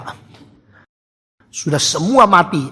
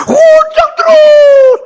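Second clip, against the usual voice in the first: slow roaring cry; 7 dB louder, much slower than usual. Acted. Heaven shaking from laughter.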